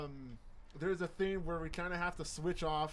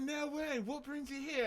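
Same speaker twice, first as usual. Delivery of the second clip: put-on high, chatty British accent; 8 semitones higher than usual. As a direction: put on a higher British voice, friendly small talk. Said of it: "you guys are doing it pretty good".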